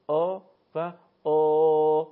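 آ و آ drawn out slow, heavy and full-bodied; slow hollow آ demonstration; heavy full vowel. Shown as a demonstration. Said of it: "اگر درشت پرحجم بخواد تلفظ بشه".

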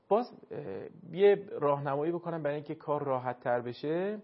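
normal talking voice

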